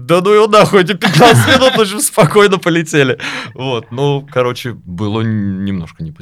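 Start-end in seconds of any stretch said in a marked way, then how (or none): 0.0-3.2 exasperated retort. Retold or imitated. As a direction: blurt it out, annoyed. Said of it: "Я говорю".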